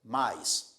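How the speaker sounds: mais in one syllable, one push of voice; one-beat mais, vowels glided together; single voice impulse. Shown as a demonstration. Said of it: "num só impulso de voz".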